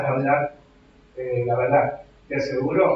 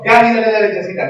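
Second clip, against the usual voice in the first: higher, louder anxious grandmother urging; imitating mother-in-law; 6 semitones higher, 8 dB louder than usual. Preacher imitating his mother-in-law urging him to feed the baby, mildly anxious. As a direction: nag like a fretting grandma. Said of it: "No podía mi suegra verla".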